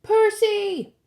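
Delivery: high, loud, urgent call; sharp warning cry. A narrator's reading. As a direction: call a friend's name in alarm. Friend calls out urgently.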